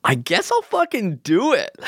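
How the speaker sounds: high-pitched comic resignation; squeaky silly voice. Pretend. Joke high voice returns.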